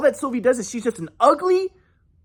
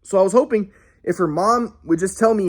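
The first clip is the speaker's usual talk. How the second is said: ordinary talk, nothing marked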